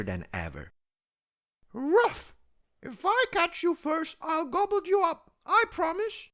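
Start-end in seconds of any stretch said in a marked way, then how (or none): 1.7-6.3 very high put-on dog voice, a loud Ruff; snappy dog barking. A narrator's reading. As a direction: bark the threat like a snappy dog. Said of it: "barked the snappy spotted dog".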